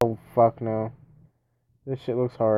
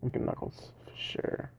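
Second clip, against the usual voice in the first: breathy whispered wish; whispering; 10 dB quieter than usual. Real, not acted. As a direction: whisper it to yourself. Whispered, then said aloud.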